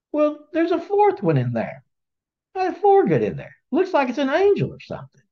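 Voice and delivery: high voiced king, puzzled and checking; astonished king. The king asking his guards about the furnace, high and puzzled. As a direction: pitch up high like a baffled ruler. King sees fourth man.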